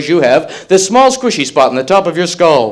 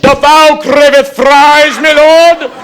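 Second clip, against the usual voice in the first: slow, high, mock medieval courtier; olde English server; 10 semitones higher, 5 dB louder, much slower than usual. Graduate at the Burger King counter, high and slow mock medieval. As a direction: ham up a groveling knightly waiter.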